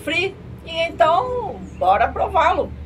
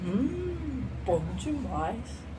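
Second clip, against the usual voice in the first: quiet savoring; 11 dB quieter, much slower than usual. Real, not acted. Savoring the drink.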